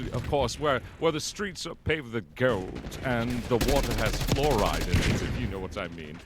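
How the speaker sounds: smug, arrogant British pontificator; Nigel Knife. Acted. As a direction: lecture with pompous British self-satisfaction, pitch often lifted. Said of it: "the most smug and just arrogant".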